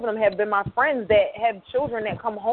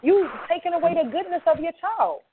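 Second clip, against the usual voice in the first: pleading; 8 semitones higher than usual. Retold or imitated. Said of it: "because I'm like".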